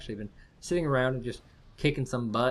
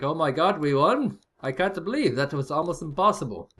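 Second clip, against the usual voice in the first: mock shocked joy; 4 semitones higher, 5 dB louder than usual. Voicing the shocked winners.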